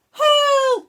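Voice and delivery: high, loud cry of panic; shrill-pitched desperate call; cry for help. Acted. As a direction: yell for help as the net closes. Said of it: "cried the fish".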